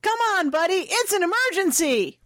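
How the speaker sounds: high, pleading call through the door; calling out for the door to open; young woman calling urgently at a locked door. A narrator's reading. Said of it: "Callie shouted, pounding on the door".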